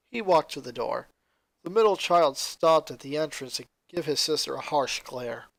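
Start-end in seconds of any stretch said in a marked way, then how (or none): none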